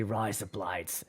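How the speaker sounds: a soft, lazy Australian accent on "Rise up, lights"; mock Australian accent. Shown as a demonstration. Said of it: "I'll teach everybody how to say something in Australian".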